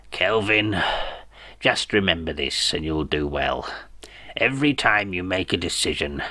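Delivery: put-on low, quiet, wheezy old tyrant; dying dictator advising his son, low and weak; dying evil dictator. A narrator's reading. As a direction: croak your last lesson, low and soft. Dying father gives evil advice.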